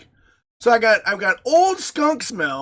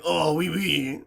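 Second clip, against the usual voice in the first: lightly put-on French; understated fake French; mock French accent; 3 semitones lower, 4 dB quieter, slower than usual. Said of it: "And my French is like".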